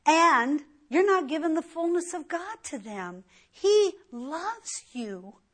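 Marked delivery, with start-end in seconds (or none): 3.5-5.4 slow, gentle and sincere; tender assurance. Real, not acted. Preacher assures the congregation of God's love, slow and tender. Preacher tells listeners God loves them.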